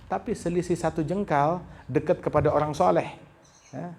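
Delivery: normal talking voice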